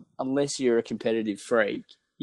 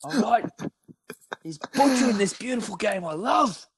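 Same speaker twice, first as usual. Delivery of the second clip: much higher, whiny excuse; exasperated fan; 11 semitones higher than usual. Retold or imitated. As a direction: whine like a hooked fan. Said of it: "I say".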